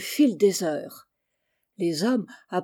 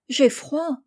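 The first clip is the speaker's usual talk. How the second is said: put-on higher small voice of a cold little boy; a lightly voiced child shivering in the cold; 6 semitones higher than usual. A narrator's reading. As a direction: shiver the words like a freezing kid. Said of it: "grelotta un bambin".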